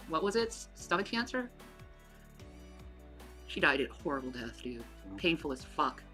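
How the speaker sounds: ordinary delivery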